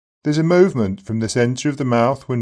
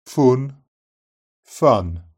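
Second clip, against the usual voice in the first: Brummie vowel in fun; Brummie accent. Shown as a demonstration. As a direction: say fun the Birmingham way. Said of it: "uh is swapped for uh".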